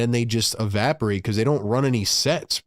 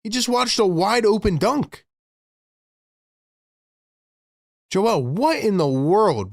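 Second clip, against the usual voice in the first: exasperated; 8 semitones higher than usual. Real, not acted. Fan exasperated at game.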